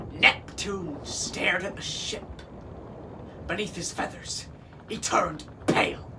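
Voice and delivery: ordinary delivery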